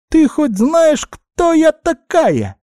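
very high squeak, slowing at the end; threatening guinea pig. A narrator's reading. Guinea pig warning who she is, indignant high squeak. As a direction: puff up and squeak a menacing challenge.

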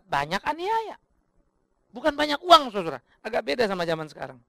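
ordinary talk, nothing marked

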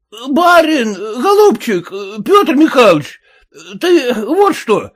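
high pleading peasant voice; high hungover peasant plea; peasant begging. Acted. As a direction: beg the master up high for a drink. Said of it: "кричал ему вслед мужик".

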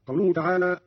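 usual speaking voice

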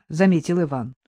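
ordinary delivery

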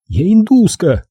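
high-pitched woman's snap; irritated, high retort; snapped retort. A narrator's reading. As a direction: snap back, pitch jumping up. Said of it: "огрызнулась она".

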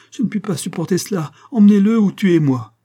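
high, building to a loud cry; desperate plea. A narrator's reading. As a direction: beg in anguish. Monique in horror at Marin. (Monique supplie, désespérée, criant)

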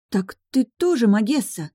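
lightly acted fright; frightened gasp. A narrator's reading. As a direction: breathe it out in alarm. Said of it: "испуганно выдохнула моя близняшка".

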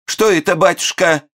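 high startled falsetto cry; frightened widow. Acted. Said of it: "испугалась вдовица".

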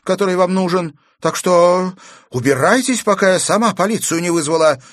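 high, loud defiant rebuke; fearless. A narrator's reading. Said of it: "бесстрашно заявила Аглая". (смело и громко дать отпор)

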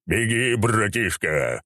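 stagy, stretched exclamation; exclaiming. A narrator's reading. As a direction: urge him on with drawn-out words. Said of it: "воскликнул Рабадон".

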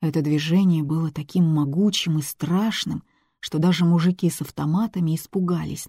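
usual speaking voice

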